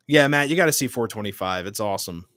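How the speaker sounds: normal talking voice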